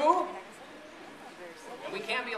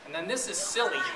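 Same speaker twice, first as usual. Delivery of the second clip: plain reading voice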